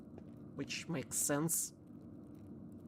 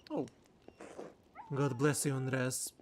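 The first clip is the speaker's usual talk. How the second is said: normal talking voice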